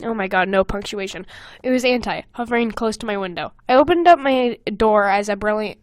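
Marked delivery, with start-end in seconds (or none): none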